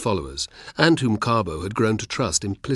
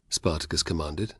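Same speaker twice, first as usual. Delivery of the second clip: ordinary delivery, nothing marked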